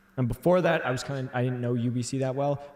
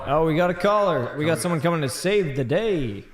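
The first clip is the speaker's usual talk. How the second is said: higher, louder hype; excited announcement; 7 semitones higher, 7 dB louder than usual. Real, not acted. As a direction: hype up the caller. Announcing a caller.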